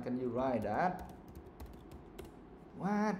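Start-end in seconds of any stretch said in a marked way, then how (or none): 2.8-3.2 high-pitched incredulous what. Real, not acted. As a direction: react in disbelief to a pupil's mistake. Teacher in disbelief at misspelling.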